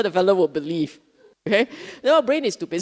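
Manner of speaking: ordinary delivery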